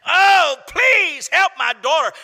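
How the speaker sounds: high, loud, slow desperate plea; desperate crying out. Acted. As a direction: cry out like a desperate mother begging. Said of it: "She was croaking like crazy".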